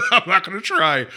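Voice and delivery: said through a real laugh. Real, not acted. Laughs while declining the accent.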